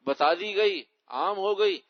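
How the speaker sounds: ordinary talk, nothing marked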